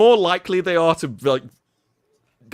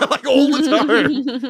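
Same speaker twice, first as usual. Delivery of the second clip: laughing through the words, high; giggling out the end of the line; 10 semitones higher, 5 dB louder than usual. Real, not acted. To friends about British banter, laughing.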